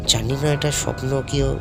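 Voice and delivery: ordinary delivery, nothing marked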